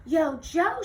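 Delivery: loud call across the yard. Acted. Melia calling to Jo over the fence, a loud call. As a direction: hail her from a distance. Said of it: "she called".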